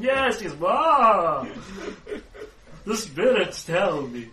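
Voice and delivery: loud, high, talking over someone; grandly overriding interruption; fortune teller. Acted. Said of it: "she just kind of talks over the top of him".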